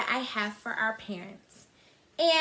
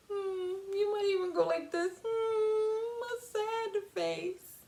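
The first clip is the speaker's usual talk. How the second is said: high, soft whimpery sad voice; sad face demo; 9 semitones higher, 3 dB quieter, slower than usual. Shown as a demonstration. Teacher showing children a sad face, soft whimper. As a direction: show the kids a sad sound. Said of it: "Can you give me a sad face?".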